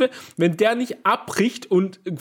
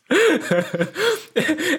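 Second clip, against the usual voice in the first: squealing laughter, much higher; 11 semitones higher than usual. Real, not acted. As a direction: burst out laughing. Laughing at friend's claim.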